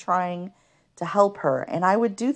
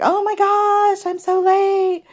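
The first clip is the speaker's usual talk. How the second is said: high, frantic schoolgirl voice; panicked girl; 5 dB louder, slower than usual. Retold or imitated. Girl at her locker panicking about being late. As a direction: squeal it like a girl running late. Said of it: "She's freaking out".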